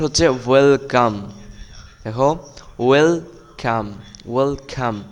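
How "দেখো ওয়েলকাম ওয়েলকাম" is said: ordinary talk, nothing marked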